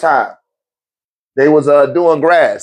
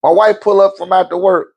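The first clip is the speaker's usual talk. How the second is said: ordinary talk, nothing marked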